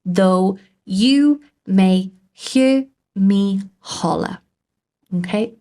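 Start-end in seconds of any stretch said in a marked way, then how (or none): 0.1-4.4 slow, each word set apart for counting; measured teacher's count with gaps between words; syllable by syllable. Shown as a demonstration. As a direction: count out "Though you may hear me holler" beat by beat. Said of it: "count the number of syllables".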